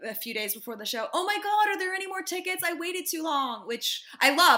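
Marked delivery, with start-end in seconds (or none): 1.1-3.7 panicky fan. Retold or imitated. Imitating fans messaging before the show, high mock panic.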